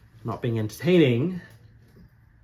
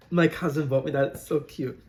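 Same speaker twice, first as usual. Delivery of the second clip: usual speaking voice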